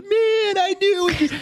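imitating a squeaky high triumphant cheer. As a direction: crow it like a fist pump. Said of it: "He's shaking his fist".

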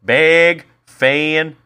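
"Big fan" loud and high, the A stretched wide; Baltimore A's. Shown as a demonstration. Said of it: "it's how they say their A's".

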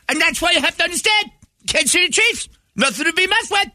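piercing, very high radio yell; shrill hollering talk-show host; Mad Dog impression. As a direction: screech it like a sports radio host at the top of his lungs. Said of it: "Like, wow, that is piercing".

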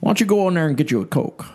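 high, quick preacher parody; Ruckman. Retold or imitated. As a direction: imitate a preacher bossing the audience about. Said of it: "here's my Ruckman impersonation".

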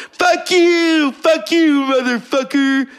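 very high, hammed-up cursing; cursing newborn. Newborn friend as doctor pulls him out, squealing curses. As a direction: squeal it as a furious foul-mouthed baby. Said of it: "That's how he comes off to me".

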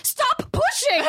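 very high, slow, drawn-out yell; mock chiding shout. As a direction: scold the crowd like an exasperated mom. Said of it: "I'm, like, chiding people".

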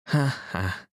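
a high, blithe little "ha, ha"; light laugh. Acted. Dot laughing fondly at her husband John, light high laugh. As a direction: tinkle out a short merry laugh. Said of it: "laughed Dot".

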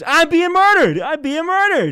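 very high, loud cry for help; mock victim's scream. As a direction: scream it like a hammed-up murder victim. Acts out a murder victim calling.

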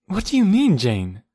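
loud, high, startled question; alarmed cry. A narrator's reading. Hazel demanding Jane's meaning, thoroughly alarmed. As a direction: cry it out in alarm, dropping on the name. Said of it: "cried Hazel, now thoroughly alarmed".

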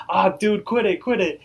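high, strained cry of pain. Retold or imitated. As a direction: yelp as it hurts. Rigby hurting as Mordecai pulls.

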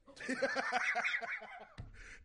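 squealing high laugh. Real, not acted. Laughs at Bryce Walker joke.

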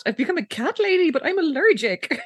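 high-pitched, incredulous imitation; mock dismay. Podcaster voices Lila shocked at being a cat lady, mock dismay. Voicing Lila's shocked reaction to future.

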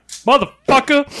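high, loud, drawn-out yell; mock shout. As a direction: bellow it like an angry dad bursting in. Pop shows up shouting.

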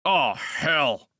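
a sharp, impatient burst; a touch of impatient annoyance. A narrator's reading. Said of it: "he began impatiently".

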